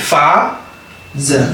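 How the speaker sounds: FATHER with a long drawn-out first A, "faaa-za"; stretched A. Shown as a demonstration. Teacher showing how to stretch the vowel, elongated FATHER. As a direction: pull the A of FATHER out long, then the second syllable apart. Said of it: "da ngay xëcc A bi".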